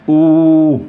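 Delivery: OU drawn out on an even note; sound demo. Shown as a demonstration. Teacher repeats the shared sound for class, long level OU. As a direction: hold the vowel OU long. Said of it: "c'est la même chose".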